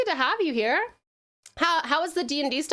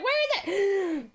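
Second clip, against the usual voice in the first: mock confused squeak; 7 semitones higher, 3 dB louder than usual. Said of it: "I was like".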